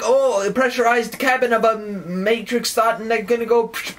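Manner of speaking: high-pitched, keyed-up dramatizing; overwrought outburst. Acted. Still acting out pressurized overload.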